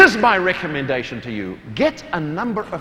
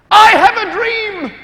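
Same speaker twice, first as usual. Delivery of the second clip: slow, much higher, loud orator's call; Martin Luther King; 13 semitones higher, 9 dB louder, slower than usual. Retold or imitated. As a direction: ring out "I have a dream" like a great orator. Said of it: "That's what he said".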